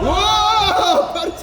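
high, loud hyped-up cheer; hype friend. Real, not acted. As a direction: scream it like a blocked shot. Hyped by a block in game.

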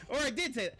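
laughing. Real, not acted. Still laughing.

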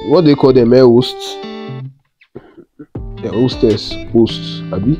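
normal talking voice

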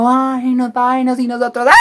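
high-pitched screamed plea to get off; reenacted panicked screaming. Storyteller reenacting her and her niece begging down, screaming. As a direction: yell it like you're stuck on a ride, imitating panic. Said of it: "y nosotros gritando".

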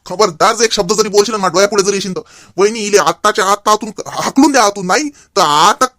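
loud, furious warning; furious threat. Acted. Father furiously threatening his daughter-in-law. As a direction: threaten to throw her out.

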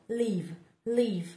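ordinary delivery

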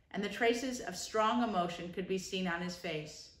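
plain reading voice

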